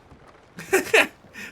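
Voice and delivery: breathless high cackle; high wheezing laugh. Real, not acted. Laughs at time jokes.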